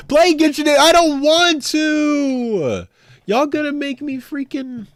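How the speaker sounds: put-on high, drawn-out whine of a kid refusing; hammed-up childish whine; whiny mock voice. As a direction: whine like a sulky child being forced. Whining mock-refusal to chat.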